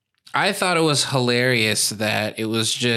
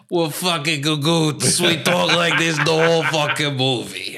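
put-on New York Italian accent; 4 semitones higher than usual. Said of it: "blatant New York Italian accent".

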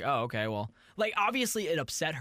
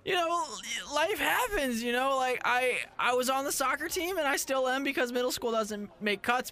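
put-on higher, rambling kid voice; middle school voice; 8 semitones higher than usual. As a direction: play a fumbling twelve-year-old boy. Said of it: "That's my middle school voice".

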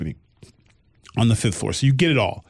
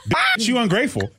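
sassy retort, higher; a kid's cheeky comeback, pitched up; daughter's sassy quip; 9 semitones higher than usual. Retold or imitated. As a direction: toss it off with attitude.

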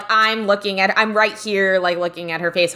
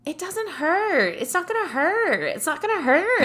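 imitated soothing; 5 semitones higher, 5 dB quieter than usual. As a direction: coo it like comforting a nervous client. Imitating waxer soothing scared client.

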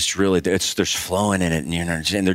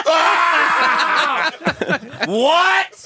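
much higher, louder startled yelp through laughter; shocked laughing shout; 4 dB louder than usual. Retold or imitated. Praying women react to the prayer, shocked laughing shout. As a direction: burst out in laughing disbelief.

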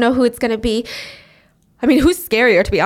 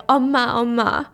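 ordinary delivery, nothing marked

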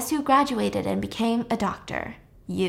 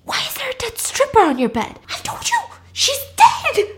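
very high mock-hysterical squeal; hammed-up panic, voice shooting up; panicked; 13 semitones higher, 6 dB louder than usual. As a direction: freak out in a strained high voice. Friend panics over the dead stripper.

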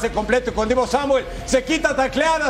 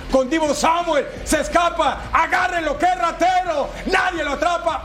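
excited play call; 6 semitones higher than usual. Real, not acted. Big play breakaway call.

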